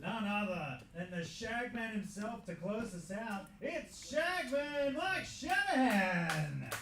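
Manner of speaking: loud, slow, high ring announcer build; announcer intro. Acted. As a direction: boom out the act like a ring announcer. Announcing comedian to stage.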